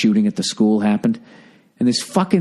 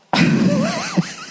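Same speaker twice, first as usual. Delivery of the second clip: high cackle. Real, not acted. Ending his own rant.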